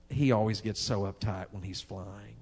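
a tender, forgiving wife's aside; said sweetly. Retold or imitated. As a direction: coo it fondly to the whole plane. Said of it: "She said it so sweetly".

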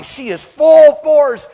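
high, very loud and drawn out; excited storytelling climax. Real, not acted. Preacher describing his dog charging at a skunk, excited shout. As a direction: belt out "full force" at top intensity. Acts out dog's explosive charge.

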